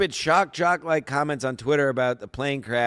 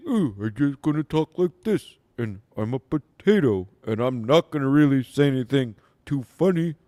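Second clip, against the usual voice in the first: dopey, lazy mock voice of a dull performer; lightly put-on dim potato voice; a hint of a dull, dopey comic mocked; mocking impression of Cardiff; 2 semitones lower than usual. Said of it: "Cardiff stinks".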